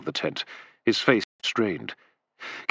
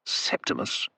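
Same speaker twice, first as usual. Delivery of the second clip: surprised whisper; 8 dB quieter than usual. A narrator's reading. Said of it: "with a look of surprise".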